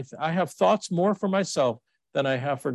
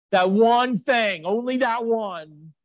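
slow and higher, leaning on "one" in "That one thing"; mocking emphasis; 7 semitones higher, 3 dB louder, slower than usual. Preacher mocking a grudge to his congregation, slow and pointed. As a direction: drag out "only that one", pressing "that". Preacher mocks the limited forgiveness.

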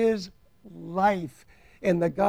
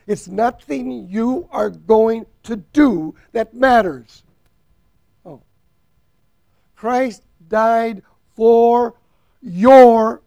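preaching at full force; 4 semitones higher, 13 dB louder than usual. Acted. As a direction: hammer each word home to the congregation.

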